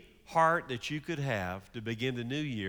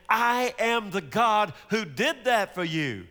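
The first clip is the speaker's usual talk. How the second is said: raised, slower proclamation as God; God proclaiming; 6 semitones higher, 5 dB louder than usual. Acted. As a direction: announce it loudly and slowly, like God reminding his people. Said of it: "Yahweh says".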